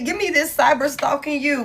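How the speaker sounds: ordinary delivery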